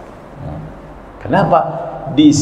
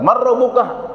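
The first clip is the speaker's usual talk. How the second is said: much higher, hammed-up question; mock angel question; 10 semitones higher than usual. Angel quizzes a Betawi man, high and playful. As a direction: pitch it up high as a playful quiz.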